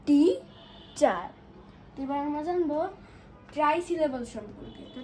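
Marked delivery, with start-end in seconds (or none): 0.1-1.3 টি then চার, each syllable said apart and loud; split into syllables. Shown as a demonstration. Teacher splitting the word for kids, syllable by syllable. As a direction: break টিচার into টি and চার. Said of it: "একটা হচ্ছে টি, একটা হচ্ছে চার".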